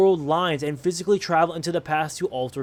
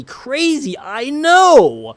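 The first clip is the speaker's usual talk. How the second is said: excited; 8 semitones higher, 9 dB louder, much slower than usual. Real, not acted. Exclaims to viewers.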